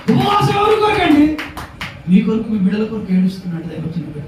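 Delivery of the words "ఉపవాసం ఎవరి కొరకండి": impassioned preaching shout. Real, not acted. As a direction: cry the question out to the whole congregation. Preacher challenging congregation. (ఆవేదనతో గొంతెత్తి ప్రశ్నించడం)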